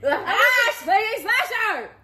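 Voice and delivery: high, drawn-out catchphrase shout; slow, high, hammed-up announcement; theatrical yell. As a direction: stretch it out and holler. Performing the requested catchphrase.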